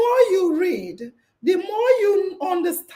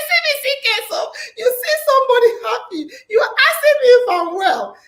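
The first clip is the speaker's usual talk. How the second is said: laughing retort; 7 semitones higher, 4 dB louder, faster than usual. Retold or imitated. Host's laughing comeback to worriers.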